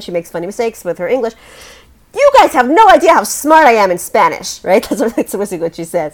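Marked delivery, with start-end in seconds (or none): 2.1-4.6 loud, high, exasperated outburst; impassioned TV-character protest, pitched up and punched out; Sofia Vergara. Retold or imitated. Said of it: "they make fun of her accent".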